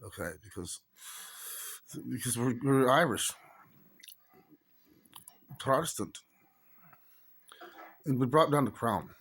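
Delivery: Irish dialect with a lightly rolled tongue; put-on Irish accent. Shown as a demonstration. Teaching viewers how to speak Irish, put-on Irish accent. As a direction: play Irish and relish it, a bit superior. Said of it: "when you speak in Irish, you gotta roll your tongue a little bit".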